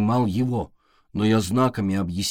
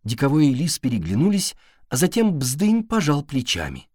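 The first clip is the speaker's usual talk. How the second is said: ordinary delivery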